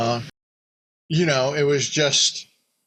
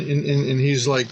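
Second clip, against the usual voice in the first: normal talking voice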